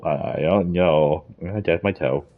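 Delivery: usual speaking voice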